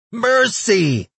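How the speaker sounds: loud, very high creature squeal; begging. A narrator's reading. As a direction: cry out desperately for your life. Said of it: "it begged".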